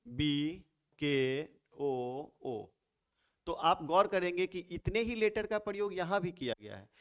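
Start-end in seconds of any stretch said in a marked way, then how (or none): 0.1-2.6 letters drawn out slowly, बी, के, ओ, ओ; spelled out. Shown as a demonstration. Teacher writing a jumbled word for the class, spelled out slowly. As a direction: spell बी के ओ ओ one long letter at a time. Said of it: "इसी शब्द को ऐसे लिखें".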